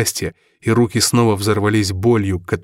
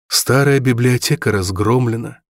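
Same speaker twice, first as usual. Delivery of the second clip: usual speaking voice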